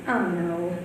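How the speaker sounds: ordinary talk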